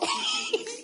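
very high, squeaky laugh. Real, not acted. Storyteller cracks up after saying the guy is dead, laughing. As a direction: crack up helplessly.

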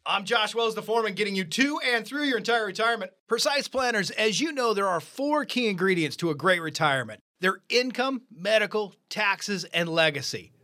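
high, bright show-opening announcer voice; radio host intro. Acted. Host welcoming listeners to the show, upbeat announcer. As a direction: pump up the listeners at the top of the show.

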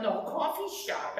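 normal talking voice

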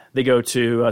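ordinary talk

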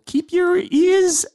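drawn-out catchphrase. Acted. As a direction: hold every word like a radio catchphrase.

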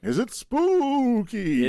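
high, drawn-out ghostly "spooky"; weird spooky voice. Pretend. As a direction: wail it slowly like a cartoon ghost. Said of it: "said spooky in that weird voice".

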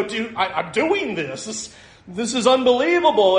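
high-pitched, mildly acted amazement; amazed realization. Preacher voicing someone who looks around on the water, amazed realization. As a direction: imitate someone stunned by their own feat.

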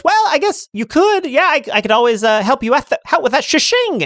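high cackling witch greeting. Acted. GM as the Dimensional Witch, high and gleeful.

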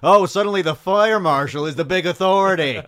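loud, high-pitched indignant rant; hammed-up rallying speech rising to a yell; Bill freaking out. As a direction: imitate a panicked man shouting everyone into action. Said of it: "freaks out about the whole".

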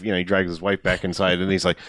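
ordinary talk, nothing marked

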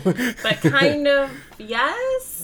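laughing, playful. Real, not acted.

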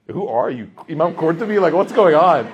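high and loud, a stunned dad; amazed disbelief. Retold or imitated. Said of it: "I'm like".

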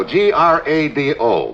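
G R A D O spelled slowly, one letter at a time; spelling out the name. Shown as a demonstration. To the commissioner, giving his cover name, spelled letter by letter. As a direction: spell G R A D O for the record. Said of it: "I'm gonna use the name Nick Grado".

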